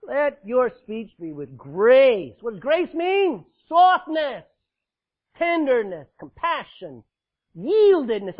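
loud, high, slow preaching on grace; full-force preaching. Acted. Pastor to congregation, preaching at full force. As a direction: pound each word from the pulpit.

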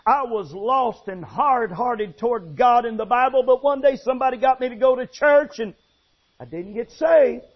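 ordinary delivery

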